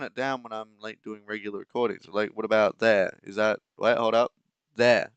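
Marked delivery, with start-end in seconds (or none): 2.1-5.1 loud, testing the sound level; loud checking. Real, not acted. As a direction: call it out while adjusting. Checking volume loudly.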